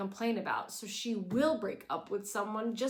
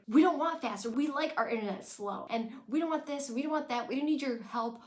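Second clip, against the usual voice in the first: loud, higher furious tirade; angry rant; 5 semitones higher, 5 dB louder than usual. Retold or imitated. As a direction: hammer each line at mom. Lorelai ranting at Emily.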